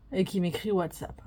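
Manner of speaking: ordinary talk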